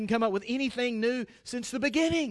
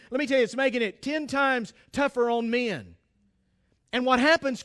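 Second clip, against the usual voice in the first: usual speaking voice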